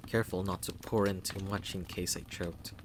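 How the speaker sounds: usual speaking voice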